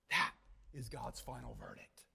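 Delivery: very soft and low; grave, quiet pronouncement; hushed solemnity. Real, not acted. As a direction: drop to a near-whisper to seal the verdict. Hushed close of the climax.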